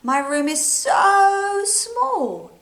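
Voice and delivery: slow, high and stretched; drawn out. Acted. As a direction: drag it out high, like it's tiny. Stretched complaint about small room.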